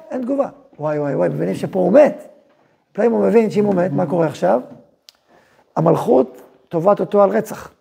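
usual speaking voice

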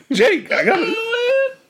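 high, slow, laughing so hard it cracks; crying with laughter; stifled giggles. Real, not acted. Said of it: "I'm crying".

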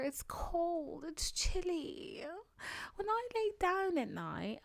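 cozy high wishful voice about winter nights; longing. Real, not acted. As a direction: sigh it out wistfully.

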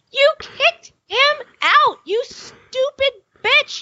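high, slow, shrieking mock tirade; furious rant at Grace. Yelling at Grace on TV, furious and tearful. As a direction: scream it at her through a half-sob. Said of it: "she said".

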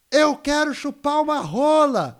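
hammed-up shout back at the show host, pitched high and drawn out; retold yell. Telling the crowd-shout moment at a show, a mild mock yell. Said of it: "E aí eu gritei assim".

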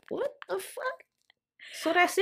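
high, stunned disbelief; shocked. Real, not acted. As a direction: gasp it out, shocked. Shocked reaction, laughing.

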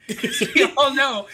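trying to talk through laughter; breaking up with laughter; laughing. Real, not acted. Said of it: "laughing fit".

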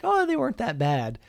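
high, breezy, shrugging it off; breezy gym friend. Retold or imitated. Retelling a gym friend's answer about Montreal delays, breezy and high. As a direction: toss it off lightly like it was nothing. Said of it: "He's like".

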